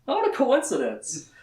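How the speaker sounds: high, rising mock surprise on "What a coincidence"; sarcastic. Shown as a demonstration. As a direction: lift "What a coincidence" way up in ironic wonder.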